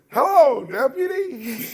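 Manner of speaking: mock greeting. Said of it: "And I said".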